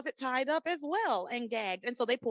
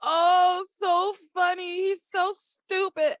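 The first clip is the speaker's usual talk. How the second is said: laughing; 8 semitones higher, 6 dB louder, slower than usual. Real, not acted. Cracking up at Hank.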